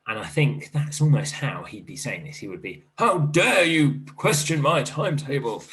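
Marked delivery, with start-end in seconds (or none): 3.0-5.6 short sharp bark, high and loud. Acted. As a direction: bark it like a dog. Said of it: "barked Mister Crass".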